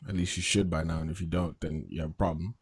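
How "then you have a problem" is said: ordinary talk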